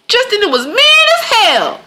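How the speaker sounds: high, slow mock declaration; lightly hammed-up emphatic exclamation; emphatic retold quote. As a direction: draw it out high, imitating the mourners. Quotes mourners at the funeral.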